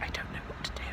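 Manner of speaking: voiceless whisper of helplessness; panicked whisper. Retold or imitated. In the pharmacy, not knowing what to do, whispering. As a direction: whisper it like you're lost.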